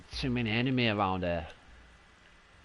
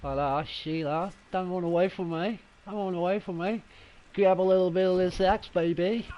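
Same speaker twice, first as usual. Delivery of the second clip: higher-pitched put-on Aussie accent; playful Aussie impression, pitched up; 7 semitones higher, 3 dB louder than usual. Shown as a demonstration. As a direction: ham it up as an Aussie. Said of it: "Do my Aus-, Aussie accent".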